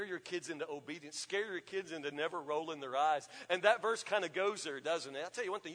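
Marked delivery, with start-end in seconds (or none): none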